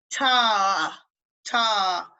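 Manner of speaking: "ta" with a long, open AH; proper long fada. Shown as a demonstration. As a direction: draw "ta" out slowly, letting it fall. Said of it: "do it properly from the beginning".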